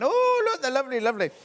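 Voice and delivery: high, sing-song gushing over the blocks; delighted gushing. Retold or imitated. Host gushing to viewers over lovely blocks, high and delighted. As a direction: coo over them like a delighted shopper. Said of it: "I'm going".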